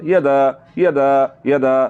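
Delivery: "यदा" starting on a brief "ya"; short quick ya. Shown as a demonstration. Teacher drills students after the mistake, short ya in यदा. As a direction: keep the first vowel of "यदा" short. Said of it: "बल्कि यदा पढ़ना है".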